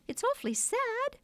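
put-on very high, chirpy child; cheerful. A narrator's reading. First twin on the parents' sorrow, cheerful. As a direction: chirp it brightly despite the sad words. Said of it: "the first twin said cheerfully".

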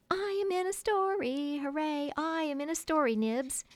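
high, singing-out cheer; jubilant child. Acted. Toodles bragging to Nibs about being in the story, jubilant. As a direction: crow with delight, pitch way up.

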